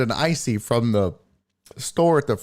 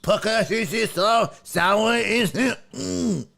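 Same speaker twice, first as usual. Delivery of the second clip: hammed-up fake French reading, slow; mock French; 6 semitones higher, slower than usual. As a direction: pretend to read the packet in French. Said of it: "it's all in French".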